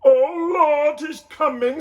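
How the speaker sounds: put-on baby is coming, panicking; panicked Joseph. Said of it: "said Joseph".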